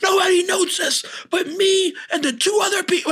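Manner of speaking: freaked-out club owner shouting; shocked shout. Retold or imitated. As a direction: yell it out, rattled and amazed. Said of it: "he goes".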